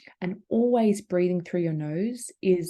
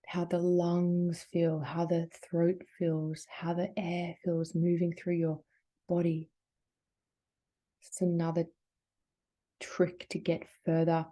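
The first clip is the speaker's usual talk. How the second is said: guided meditation; 5 dB quieter than usual. Acted. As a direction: ease them into calm, drawing words out. Leads a slow breathing meditation.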